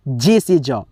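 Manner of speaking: ordinary delivery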